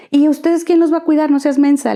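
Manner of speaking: imitating her mother's annoyed put-down; scolding mother. Mother brushing off her daughter's plan, mock scolding.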